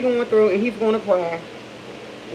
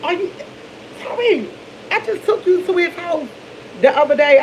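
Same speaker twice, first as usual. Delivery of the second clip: high-pitched stunned question; shocked disbelief; 7 semitones higher than usual. Retold or imitated. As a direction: caught off guard, voice jumps up in disbelief. Mom's shocked reply to son.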